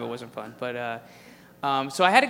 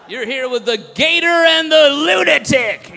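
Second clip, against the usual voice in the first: loud, far higher hype DJ; zoo DJ shout; 10 semitones higher, 10 dB louder than usual. Retold or imitated. DJs announce their show names, loud DJ voice. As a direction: yell the show name like a DJ. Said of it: "who talks like this".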